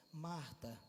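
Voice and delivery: soft, low, tranquil address; calm. Shown as a demonstration. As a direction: say Marta quietly, without any push. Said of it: "É tranquilo ou não é?".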